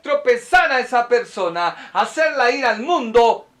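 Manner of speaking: impassioned pulpit delivery, climbing; fiery preaching. Real, not acted. Preaches at rising force.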